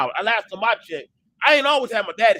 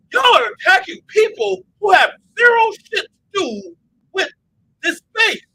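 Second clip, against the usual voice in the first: much higher and louder, slowed, furious call-out; outraged shout; 13 semitones higher, 7 dB louder than usual. Retold or imitated. He shouts at the space for doxxing other people. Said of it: "And I'm like".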